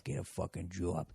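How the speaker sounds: put-on low, soft mutter in Boston accent. Listeners muttering to themselves in the cubicle, muttering. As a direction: mutter it to yourself under your breath. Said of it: "just muttering to yourself".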